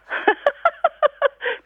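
high, bursting laugh out of nothing; demo laugh. Shown as a demonstration. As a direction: laugh for no reason at all. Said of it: "kann man doch ohne Grund lachen". (grundloses hohes Lachen)

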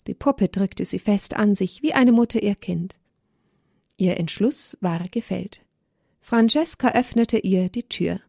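plain reading voice

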